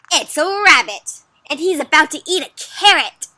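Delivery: high, loud storybook rebuttal; animated, emphatic disagreement; insistent counter-claim. Acted. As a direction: counter eagerly with your own version. Rabbit believer counters.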